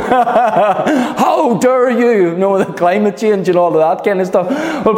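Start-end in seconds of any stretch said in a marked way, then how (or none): 0.1-2.3 very slow, drawn-out "How dare you?" in a put-on voice, laughing through it; mock impression of a teenage activist scolding world leaders; Greta Thunberg impression. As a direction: do the climate activist girl's outraged line. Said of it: "I get all Greta".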